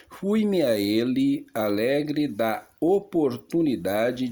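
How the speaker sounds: strong, clear stage declamation to oneself; voz forte e clara. Acted. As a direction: declaim the paragraph as if composing it aloud. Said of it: "falando para si mesmo em voz forte e clara". (declamação em voz forte e clara)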